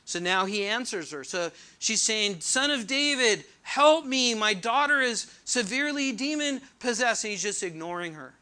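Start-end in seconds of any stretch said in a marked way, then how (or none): 2.4-7.2 raised, louder appeal for help; urgent plea. Acted. Mother begs Jesus for her possessed daughter, urgently pleading. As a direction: call out higher and louder, pleading.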